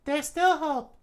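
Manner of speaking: very high, loud falsetto; hopeful princess. Acted. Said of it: "she said".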